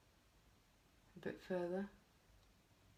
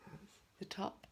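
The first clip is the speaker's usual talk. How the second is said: whispering; 2 semitones higher, 9 dB quieter, much slower than usual. Real, not acted. Muttered while painting.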